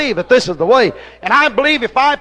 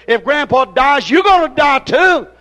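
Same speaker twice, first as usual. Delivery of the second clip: refrain pushed higher and louder; pulpit shout; 4 semitones higher, 4 dB louder than usual. Acted. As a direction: hammer the refrain home. Refrain repeated, climbing louder.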